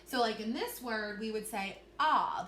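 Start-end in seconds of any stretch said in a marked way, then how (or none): 2.0-2.4 "ah" as a short open O sound; teacher sounding out "ah". Shown as a demonstration. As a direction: model the sound "ah" clearly for the class. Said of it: "that short vowel sound".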